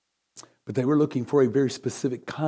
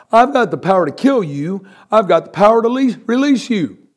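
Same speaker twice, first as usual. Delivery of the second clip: boastful Pilate; 9 semitones higher, 8 dB louder than usual. Acted. Pilate threatening Jesus with his power, loud boast.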